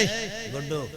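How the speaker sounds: high-pitched mock fatherly call; lightly voiced father hailing his boy; father calling his son. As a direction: call out to the child like a stern dad. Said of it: "تو باپ کہتا ہے".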